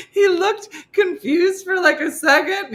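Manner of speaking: high, cracking laugh through the words; laugh-cry while telling it; words breaking with laughter. Real, not acted.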